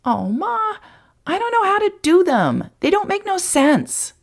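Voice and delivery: put-on boy complaining about his math; whining boy. A narrator's reading. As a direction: drag it out in protest.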